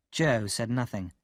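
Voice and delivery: usual speaking voice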